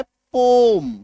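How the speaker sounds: पोम drawn out as one long syllable; actual pronunciation. Shown as a demonstration. Teacher answering own question on the actual pronunciation, पोम held long. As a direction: stretch the long o in पोम, closing on m. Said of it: "इसका actual उच्चारण क्या है?".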